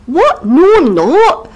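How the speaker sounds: high, startled mock Scottish protest; Macbeth. Macbeth baffled by the witches' title, high mock Scottish. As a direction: deny it in a comic Scottish accent. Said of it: "Macbeth's a bit like".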